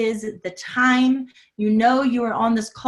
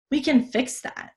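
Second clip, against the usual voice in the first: normal talking voice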